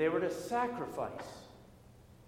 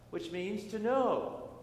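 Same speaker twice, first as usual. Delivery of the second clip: ordinary delivery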